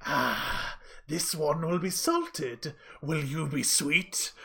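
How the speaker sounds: slow, odd creature voice savouring its prey; cackling monster. Acted. GM voicing the monster eyeing its prey, slow creepy drawl. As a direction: drawl it out like a hungry hag. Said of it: "She just starts cackling and going".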